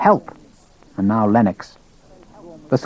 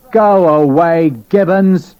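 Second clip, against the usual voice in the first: disdainful; 6 semitones higher, 4 dB louder, slower than usual. A narrator's reading. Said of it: "Sharp spoke with an equal disdain".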